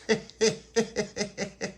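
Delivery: put-on little boy's high giggle; high-pitched giggle. Little pappy giggling about Tony's fall, high-pitched giggle. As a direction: giggle high at a funny memory. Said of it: "What are you laughing at".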